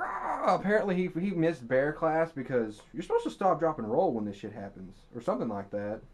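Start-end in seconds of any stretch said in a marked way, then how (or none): 0.0-0.6 high mock yell of pain; hammed-up short "Ah!"; 1 mock scream. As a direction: cry out like the mauled man. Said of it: "He's like".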